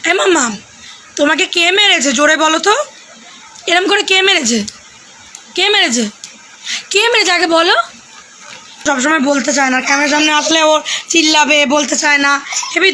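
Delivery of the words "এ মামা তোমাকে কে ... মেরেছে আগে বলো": put-on coaxing a child. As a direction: playfully quiz the child, bright and coaxing. Asks child again who hit.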